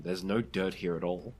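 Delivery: ordinary delivery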